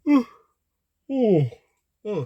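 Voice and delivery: words stretched into a yawn, then apologizing. Real, not acted. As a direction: let a big yawn swallow the words. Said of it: "I'm yawning".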